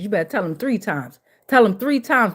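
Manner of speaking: ordinary delivery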